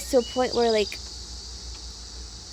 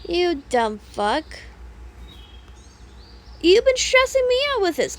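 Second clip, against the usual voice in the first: retold angry retort; 5 semitones higher than usual. Retelling her reply to boyfriend, mock angry yell. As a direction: fire it back in a hammed-up yell. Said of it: "I was all".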